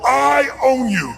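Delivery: slow, stretched challenge; challenging claim. Shown as a demonstration. Coach models line to say to partner, slow challenge. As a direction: draw it out as a dare. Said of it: "point at them and go".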